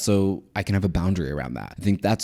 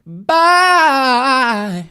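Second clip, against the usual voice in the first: very high, loud sing-song bye; squealed bye; 9 dB louder than usual. Real, not acted. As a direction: shriek the goodbye again. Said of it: "we're gonna go again".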